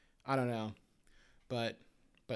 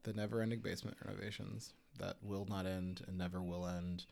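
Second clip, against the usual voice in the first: low and soft, a dragging sigh of a line; understated mock despair; weary mock lament; 6 semitones lower, 12 dB quieter than usual. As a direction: groan it out like an endless chore. Mock-dramatic echo of complaint.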